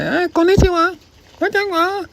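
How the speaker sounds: put-on very high, tiny child voice saying konnichiwa; little child. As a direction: squeak it like a small kid. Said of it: "You're like".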